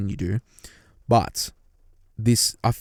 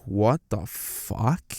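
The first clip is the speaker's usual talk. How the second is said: slow, drawn-out shocked reaction; stunned disbelief; 5 dB quieter, much slower than usual. Retold or imitated. Hearing a hurtful remark from a partner, slow stunned disbelief. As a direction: let the disbelief hang, slow. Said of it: "I'd be like".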